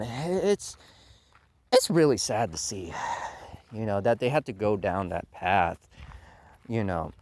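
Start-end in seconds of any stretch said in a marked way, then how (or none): none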